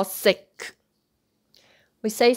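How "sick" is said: "sick" ending in a clearly popped K; wrongly released K. Shown as a demonstration. Teacher warns against the English way, "sick" with released K. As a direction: finish "sick" on an audible burst of K. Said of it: "don't say".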